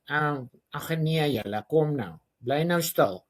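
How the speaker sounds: lightly voiced nasal Afrikaans teacher. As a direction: imitate a nasal schoolteacher's whine. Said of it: "the one that goes through the nose".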